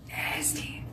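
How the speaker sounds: breathy whispered repeat of nasty; a mocking aside whisper; whispered echo. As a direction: hiss the word under your breath. Echoing the judgment.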